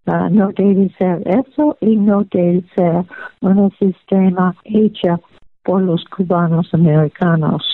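ordinary talk

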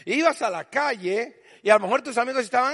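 normal talking voice

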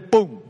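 a loud, sudden mock bang. Shown as a demonstration. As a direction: burst it out like a thunderclap. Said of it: "de repente".

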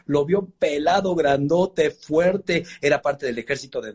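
usual speaking voice

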